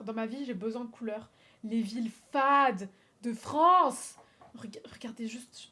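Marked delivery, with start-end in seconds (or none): 1.6-4.2 loud disdain. Real, not acted. Mock scornful proclamation. 4.6-5.7 whisper. Real, not acted. Whispered aside.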